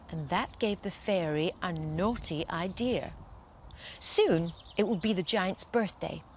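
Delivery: ordinary delivery, nothing marked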